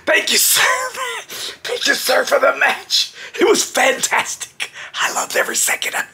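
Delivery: high, strained voice with no air; still winded. Retold or imitated. Winded wrestler thanking Steiner for the match, acted breathlessness. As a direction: squeeze out polite thanks while badly winded, fading to breath. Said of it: "I still couldn't breathe".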